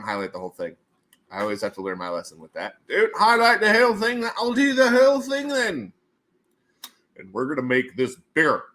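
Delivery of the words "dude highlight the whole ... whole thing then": high, loud mock protest; hammed-up goofy exclaiming; put-on goofy voice. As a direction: push it up into a silly loud voice. Mock reply to highlighting mishap.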